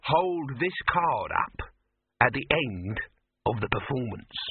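slow, deliberate words; measured, careful persuasion. Acted. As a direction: spell it out as if to a child. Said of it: "speaking very carefully".

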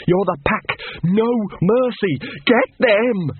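building from a sharp snap to a high yelled order; snapping. Acted. Big Fido ordering the pack to attack, snapping into a yell. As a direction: whip the pack up until it screams. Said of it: "snapped Big Fido".

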